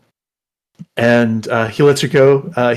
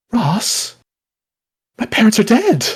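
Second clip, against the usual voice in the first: raised, higher woman's voice, a bit stunned; 8 semitones higher, 4 dB louder than usual. Acted. Startled woman answering the kneeling suitor. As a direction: pitch it up as the startled bride.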